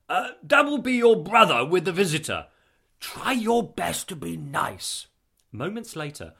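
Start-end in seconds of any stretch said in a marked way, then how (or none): 0.1-5.1 high, loud, eager father; raised, flustered voice; relieved announcement. A narrator's reading. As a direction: announce the guest with relief, then admonish.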